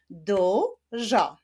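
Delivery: "Dużo" in two separate drawn-out syllables; split into syllables. Shown as a demonstration. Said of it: "Wyklaszczmy sylaby w tym wyrazie".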